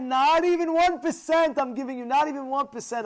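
high, loud, insistent no; emphatic refusal. Acted. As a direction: refuse outright, raising the voice. Firm refusal of the offer.

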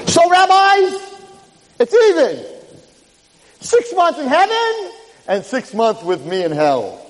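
forceful, high-pitched prosecutor. Retold or imitated.

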